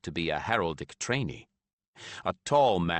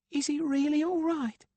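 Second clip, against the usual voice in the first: put-on high, light young girl voice; lightly voiced young girl; girl's voice. A narrator's reading. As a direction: voice an eager girl. Girl Macy voiced by narrator.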